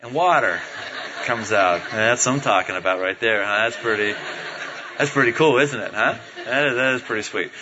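dropped pitch, savouring it; low-voiced relish. Real, not acted. Preacher savours water from the rock, low relish. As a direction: drop low and relish it, half laughing.

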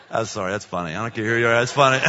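low, chuckling apology; amused laughter. Real, not acted. Speaker to congregation after his Kentucky joke, amused laughter. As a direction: talk through a chuckle, pitched low.

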